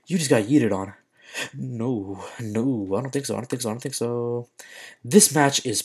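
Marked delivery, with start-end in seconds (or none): 1.5-4.5 soft repeated no under the breath; muttered denial. Real, not acted. Gamer losing the match, muttering denial under his breath. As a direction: murmur it to yourself, quieter.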